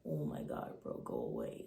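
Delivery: put-on tired narrator mutters at the staring frat boy; fed-up muttering. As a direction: brush him off, totally over it. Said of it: "I'm like".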